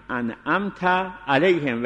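انعمت rising, a gap, then علیهم; clear pause after an'amta. Shown as a demonstration. Teacher repeats انعمت علیهم split in two.

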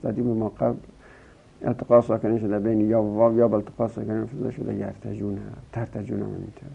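ordinary delivery

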